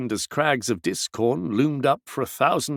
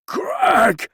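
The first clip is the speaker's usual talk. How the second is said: higher, louder strangled shout; choked cry; 10 semitones higher, 5 dB louder than usual. A narrator's reading. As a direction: force the name out through a choking throat. Maskell bursts out, choking.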